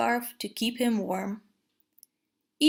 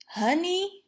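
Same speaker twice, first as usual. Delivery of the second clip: hungry eager question; 7 semitones higher than usual. A narrator's reading. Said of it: "Pooh hungrily asked".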